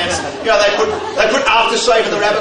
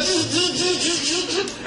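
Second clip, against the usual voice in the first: high, soft buzzing of sheep shears; mock clipper noise, fading out; shearing clipper buzz; 6 semitones higher, 7 dB quieter, much slower than usual. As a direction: imitate the electric shears with a buzz. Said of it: "And he goes".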